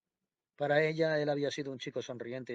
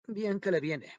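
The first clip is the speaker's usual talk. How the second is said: harsh, higher and louder invalid's jab; hard voice; 7 semitones higher, 5 dB louder, slower than usual. A narrator's reading. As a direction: spit it out like a bitter invalid. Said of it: "la voz dura de la inválida".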